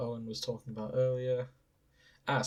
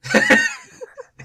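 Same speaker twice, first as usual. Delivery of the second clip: high burst of laughter; 12 dB louder than usual. Real, not acted.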